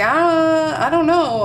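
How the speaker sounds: high, loud overwhelmed cry of "Ah, I don't know"; overwhelmed exclamation. Retold or imitated. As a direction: blurt it out high and loud, swamped and unsure. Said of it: "and it's kind of like".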